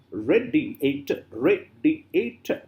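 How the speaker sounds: "Radiator" slowly split into ra, di, a, tor; syllable by syllable. Shown as a demonstration. Teacher asking how many syllables, slow syllable by syllable. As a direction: spell out "Radiator" by ear, beat by beat. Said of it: "How many syllables are there?".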